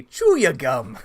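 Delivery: movie quote. Retold or imitated. Quoting Spaceballs Marlene/Charlene.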